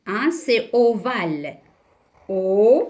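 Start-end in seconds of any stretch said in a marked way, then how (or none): 2.3-2.8 syllable by syllable. Shown as a demonstration. Teacher dictating the shape's name, syllable by syllable. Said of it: "mais aussi cette forme".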